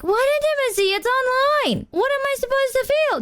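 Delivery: high-pitched mock bafflement; incredulous protest. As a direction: retell it as an exasperated squeak of disbelief.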